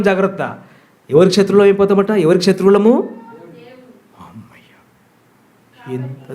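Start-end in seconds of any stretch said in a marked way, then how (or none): 4.2-6.3 mock relieved whisper. Mock relief at bold congregation.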